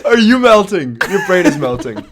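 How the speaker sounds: loud, incredulous question through laughter; laughing, emphatic mock concern; laughing disbelief. Real, not acted. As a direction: tease a friend while cracking up.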